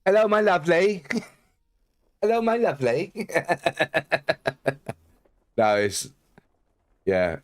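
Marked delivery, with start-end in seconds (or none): 0.1-1.0 high, hammed-up Bristolian greeting; badly faked Bristolian, pitched high; Bristolian accent. Streamer trying the accent for chat, Bristolian accent. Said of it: "don't do a very good Bristolian accent". 2.2-3.1 high, hammed-up Bristolian greeting; badly faked Bristolian, pitched high; Bristolian accent. Streamer trying the accent for chat, Bristolian accent. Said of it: "don't do a very good Bristolian accent".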